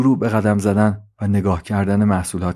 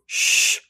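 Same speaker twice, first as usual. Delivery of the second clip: drawn-out "shhh"; long shush; 3 dB quieter than usual. Acted. Suzi silences Trolley, a long shush. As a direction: hush them with a long hiss.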